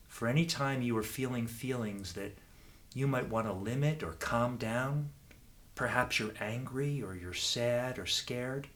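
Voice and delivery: ordinary talk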